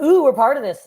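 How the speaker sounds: high, loud thrilled crowd; imitated audience excitement. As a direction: play a delighted audience member.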